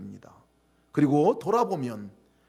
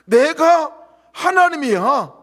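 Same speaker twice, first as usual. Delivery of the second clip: much louder, very slow, higher; God's voice; 10 semitones higher, 13 dB louder, much slower than usual. Pretend. Preacher voicing God to the church, thundering. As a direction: thunder it out slowly. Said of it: "하시는 음성이었어요".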